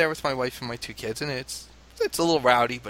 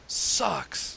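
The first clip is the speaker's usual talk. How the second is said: imitating crowd yell; 5 semitones higher than usual. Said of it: "the whole stadium yells".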